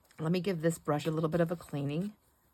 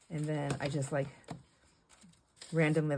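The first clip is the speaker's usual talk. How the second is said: ordinary delivery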